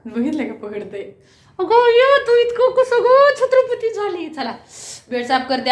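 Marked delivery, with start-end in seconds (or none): 1.6-4.3 loud, high teasing scold; mock scolding. Real, not acted. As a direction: tease her loudly like a scold.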